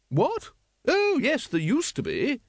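very high, surprised lady's voice; grand lady. Acted. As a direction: start high, then settle as you recall. Lady Ramkin answers the captain.

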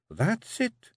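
high, firm Scots woman's voice; Jeanie the Kelda. A narrator's reading. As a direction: coax him like a wife who rules the clan. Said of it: "she said at last".

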